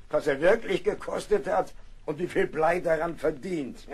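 ordinary talk

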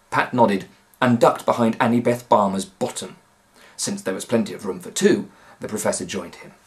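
usual speaking voice

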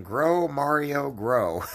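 high, slow yelled Grow, Mario on the retelling; mock yell. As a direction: shout it out as the punchline. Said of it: "started yelling".

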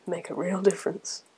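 ordinary delivery

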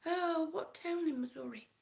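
usual speaking voice

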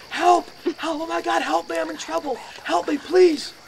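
high, loud desperate shouts; shouting for help. Retold or imitated. Banging on a neighbour's door, loud desperate shouts.

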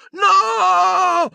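very high, loud scream; panicked shriek. Acted. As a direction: scream it in terror. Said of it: "sentì strillare la Umbridge".

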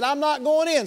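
high, loud defiant refusal; a resentful son digging in; older brother refusing to come inside; sulky son. Acted. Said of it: "This son said".

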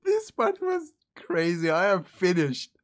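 breaking up laughing mid-sentence; words squeezed out through laughter. Real, not acted. Laughing hard at the clip.

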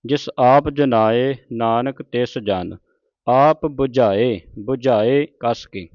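slow, measured verse reading; scripture recitation. Shown as a demonstration. Teacher reciting Gurbani to the congregation, slow and measured. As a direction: draw out each sacred word.

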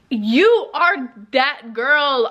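drawn-out, each word landed; slow affirmation to yourself; slow emphatic hype. Real, not acted. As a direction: stretch it out like a pep talk. Hyping herself up.

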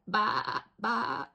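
loud bleating baa; sheep baa. Acted. The sheep baaing instead of sleeping.

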